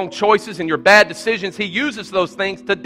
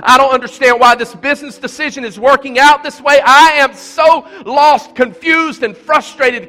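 high, loud cry of despair; screaming out; 10 semitones higher, 7 dB louder than usual. Retold or imitated. Pastor voicing a desperate churchgoer, loud anguished cry. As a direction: shout it out to heaven. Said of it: "you're screaming out".

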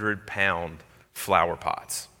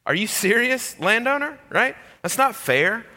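high, louder indignant whine; mock whining complaint; 13 semitones higher, 3 dB louder than usual. Worker protesting to the landowner, high whiny indignation. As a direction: pout it out like a sulky kid.

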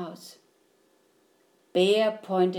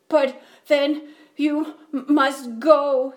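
higher, tearful, halting plea; sniffling; 3 semitones higher, 6 dB louder than usual. A narrator's reading. Bear gives in to the mouse, sniffling. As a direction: stammer through acted tears. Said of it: "he sniffled".

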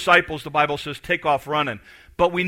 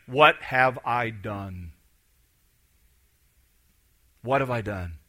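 Peter's remorse; 6 semitones lower, 4 dB quieter, slower than usual. Retold or imitated. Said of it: "wrestle with".

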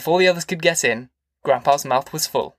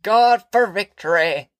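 put-on high, drawn-out bleat of an old woman; bleated praise; 7 semitones higher, 3 dB louder, much slower than usual. A narrator's reading. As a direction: bleat it like a pious granny.

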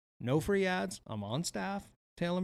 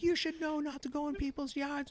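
mock high neighbour voice; 14 semitones higher than usual. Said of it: "she's like".

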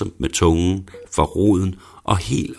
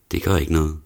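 ordinary delivery, nothing marked

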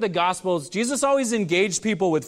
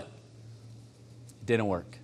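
hushed aside; 10 semitones lower, 13 dB quieter, much slower than usual. Real, not acted. Sad letdown after guess what.

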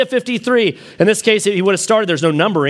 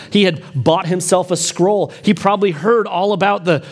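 normal talking voice

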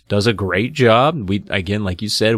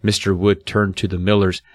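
ordinary delivery, nothing marked

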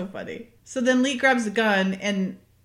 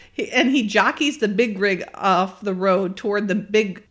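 ordinary talk, nothing marked

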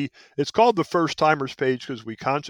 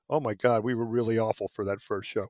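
mock cringe; 4 semitones lower, 5 dB quieter than usual. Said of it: "and go".

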